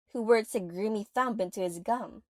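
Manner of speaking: ordinary talk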